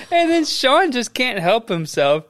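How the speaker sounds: ordinary talk, nothing marked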